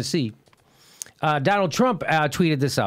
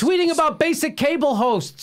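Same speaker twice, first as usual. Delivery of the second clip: high-pitched, exasperated disbelief; incredulous outburst; 10 semitones higher than usual. Real, not acted. Host reacting to a candidate's tweet, incredulous.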